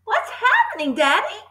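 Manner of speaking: put-on high-pitched child's question; alarmed cry. A narrator's reading. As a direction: ask Daddy in high alarm. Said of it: "she cried".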